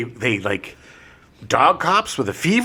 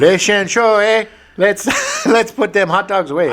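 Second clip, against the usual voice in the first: hammed-up French Canadian accent, laughing through it; Les Chenchots said the French way, laughing; mock French Canadian; 5 semitones higher, 3 dB louder than usual. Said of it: "supposed to be in French".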